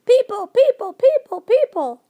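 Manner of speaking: very loud, high repeated screech; screaming creatures. A narrator's reading. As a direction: scream the alarm as loud as possible. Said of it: "they were screaming".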